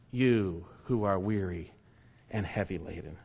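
as if Jesus calls the weary to him; gentle invitation. Acted. Preacher voicing Jesus inviting the weary, gently.

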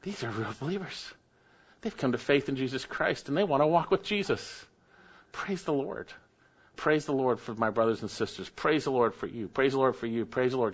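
soft, murmured inner prayer of praise; hushed, sincere thanks to God under the breath; quiet heartfelt praise. Pretend. Preacher voicing a believer's private prayer, softly murmured. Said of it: "We don't go".

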